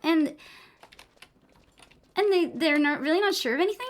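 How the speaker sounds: mock influencer uptalk, every phrase rising like a question; a hint of Valley Girl question-mark cadence. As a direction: lightly parody the LA influencer voice. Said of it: "they talk like this and everything is a question".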